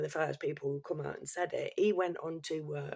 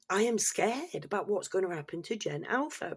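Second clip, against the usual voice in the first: ordinary delivery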